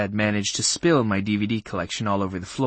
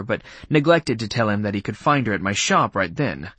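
ordinary delivery

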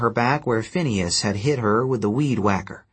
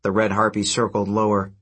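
ordinary delivery

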